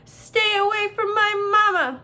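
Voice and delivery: high, loud gosling cry; squawked shout. A narrator's reading. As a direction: squawk fiercely to protect your mother. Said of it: "there was a loud squawk".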